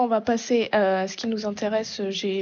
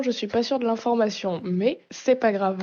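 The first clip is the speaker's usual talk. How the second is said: ordinary talk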